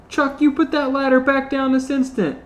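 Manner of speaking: high, sharp scolding snap; snapped. Acted. Panty ordering Chuck to put the ladder back, snapping. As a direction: snap at him like a cross mom. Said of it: "Panty snapped".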